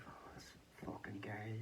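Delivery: exasperated mutter. Retold or imitated.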